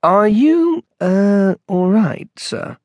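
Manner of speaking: high, hesitant polite enquiry; tentative venturing. A narrator's reading. Manager checking on odd customer, tentative. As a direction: feel your way carefully into the question. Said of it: "ventured the manager".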